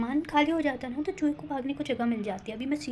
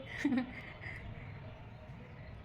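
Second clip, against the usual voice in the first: soft unvoiced giggle under her breath; breathy giggle; 9 dB quieter than usual. Real, not acted. Vlogger laughing at her own brave plan, a soft giggle. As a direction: laugh quietly at yourself.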